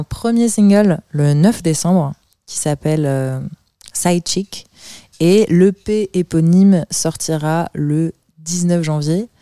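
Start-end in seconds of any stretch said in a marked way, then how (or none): none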